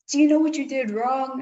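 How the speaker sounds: angry teacher confronting a student in the hallway; mock angry demand. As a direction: bark the question at the kid. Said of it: "he got really mad".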